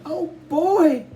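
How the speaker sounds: very high, loud delighted squeal. A narrator's reading. Little Joey seeing the record player, squealing. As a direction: squeal with pure joy. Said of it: "Joey squealed".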